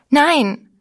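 sharp, high, loud scream; shrieked refusal. A narrator's reading. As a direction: fling the word out in panic. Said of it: "schrie sie".

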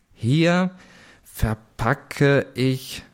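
very slow, halting word by word; deciphering. A narrator's reading. Narrator struggles to decipher the writing, halting and slow. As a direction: read haltingly, as if making out each word. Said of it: "schwer zu entziffern".

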